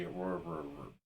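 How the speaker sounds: soft fading troll growl; internet troll growl. Retold or imitated. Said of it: "my internet troll voice would be".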